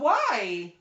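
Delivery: high, loud whine of a hurt woman; high whiny woman. Acted. Game master voicing the slapped woman, high loud whine. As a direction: squeal it like an offended girlfriend. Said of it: "She goes".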